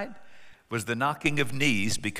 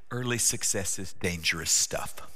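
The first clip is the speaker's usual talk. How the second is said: lower, softer aside; quietly; 7 semitones lower, 5 dB quieter than usual. Real, not acted. Preacher warning the congregation, quietly. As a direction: say it quietly and gravely. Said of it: "may I quietly say".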